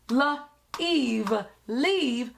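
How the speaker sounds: "l, eve" apart, then blended as "leave"; sounding out. Shown as a demonstration. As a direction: say "l", pause, "eve", then join them. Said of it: "sound out and break down".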